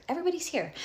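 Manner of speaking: high exasperated repeat of "Everybody's here"; exasperated emphasis. Retold or imitated. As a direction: throw "Everybody's" up high in disbelief.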